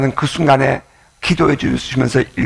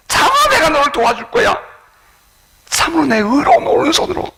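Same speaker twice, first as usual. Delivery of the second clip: God's reassuring voice. Retold or imitated. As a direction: reassure a despairing child as God. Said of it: "그의 얼굴을 돌리시면서". (하나님이 절망한 사람을 높은 목소리로 달래다)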